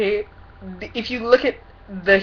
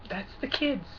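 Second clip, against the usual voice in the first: soft; 11 dB quieter than usual. Retold or imitated. Vlogger mimicking a pro-life politician, soft. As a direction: say it softly, trailing down. Mock quote, first take.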